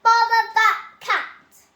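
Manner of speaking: loud, high, slow fed-up cry; exasperated outburst. A narrator's reading. Missus Thomas annoyed at the cat, loud slow outburst. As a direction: blurt it out at the cat, slowly. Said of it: "Missus Thomas said".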